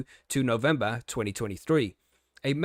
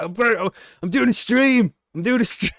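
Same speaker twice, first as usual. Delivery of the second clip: higher, louder, strangled self-restraint; mock outburst; 8 semitones higher, 7 dB louder than usual. Reacting to the article mid-stream, half-yelled and high. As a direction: yell it while biting it back. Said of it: "and I was like".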